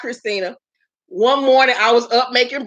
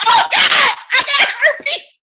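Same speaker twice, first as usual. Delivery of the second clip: panicked shriek; 11 semitones higher than usual. Retold or imitated. Finding a bump on her lip, she shrieks in high panic. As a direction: shriek it out in mock terror.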